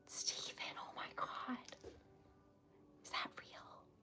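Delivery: barely audible, breathless disbelief; whispering, too stunned to speak up. Real, not acted. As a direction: whisper it in total shock. Shocked by a donation.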